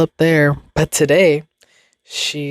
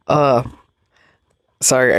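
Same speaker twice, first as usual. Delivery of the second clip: ordinary delivery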